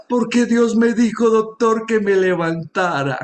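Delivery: high, impassioned preaching testimony; fervent preaching. Acted. Pastor testifying to his congregation, fervent preaching. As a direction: preach it to the congregation with rising fervor.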